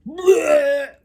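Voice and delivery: loud, high retching sound; vomiting noise. Retold or imitated. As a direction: act out throwing up loudly. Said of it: "and I just go".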